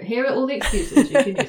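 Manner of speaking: joking, loud sarcastic proclamation. Pretend.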